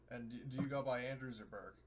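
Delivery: ordinary delivery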